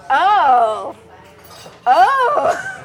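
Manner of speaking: dawning shock; retold sudden realization.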